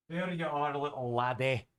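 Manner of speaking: put-on cooing Scottish baby talk; playful Scottish accent. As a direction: coo to a baby with a Scottish lilt. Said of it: "I've been talking to him like that".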